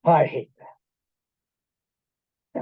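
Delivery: a low, sinking "oh no" at the lights; dismayed dread. Retold or imitated. To the audience, the driver seeing the police lights, low dismayed dread. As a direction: drop the voice low and drag out the dread. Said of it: "you're like".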